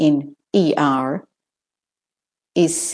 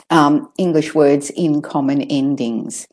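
normal talking voice

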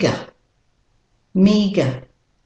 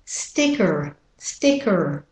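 American "sticker" with a sounded R; American-accent word demo; 3 dB quieter, slower than usual. Shown as a demonstration. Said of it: "And with an American accent".

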